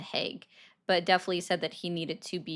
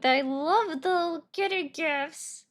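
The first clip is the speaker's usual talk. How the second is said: cutesy read; 9 semitones higher, 3 dB louder, slower than usual. Pretend. Reading a viewer's chat message.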